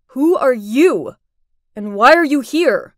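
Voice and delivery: loud, stern challenge at normal pitch; frowning demand. Acted. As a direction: question an intruder firmly, with a frown. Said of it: "He frowned at me and said".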